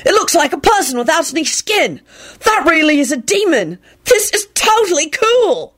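put-on high, thrilled boy; exultant. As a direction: delight at the monster.

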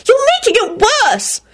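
very high screeched rebuke; shrill scream. A narrator's reading. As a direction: shriek at him to stop. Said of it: "screamed Rosie".

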